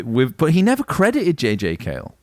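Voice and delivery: usual speaking voice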